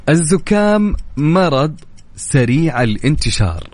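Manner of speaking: slow, deliberate reading, word by word; slow dictation. Shown as a demonstration. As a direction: spell out each word slowly for the caller. Reading the game sentence aloud.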